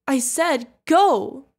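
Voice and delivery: loud, drawn-out order; commanding shout. Acted. Guardian orders the kid to run, commanding shout. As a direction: shout it slowly and forcefully.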